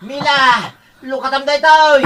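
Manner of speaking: high call from outside the door. Acted. Father calling to his daughter from outside. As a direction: call her name from outside.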